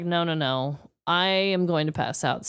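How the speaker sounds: ordinary delivery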